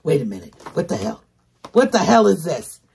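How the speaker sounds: usual speaking voice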